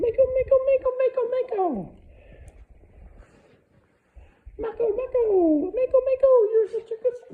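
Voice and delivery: very high, loud excited squealing. Shown as a demonstration. Trainer proofing the dog's down, high-pitched squeal. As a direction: squeal the name over and over to tempt the dog. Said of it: "with a higher pitched voice".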